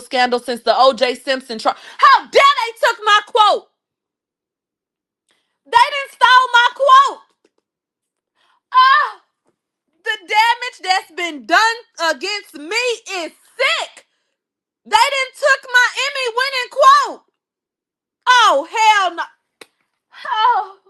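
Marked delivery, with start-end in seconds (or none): 2.0-7.2 much higher, indignant diva voice; hammed-up indignation; mock outrage. Mock outrage over stolen quote. 8.7-20.8 high, theatrical wounded voice; mock tragic lament; dramatic diva lament.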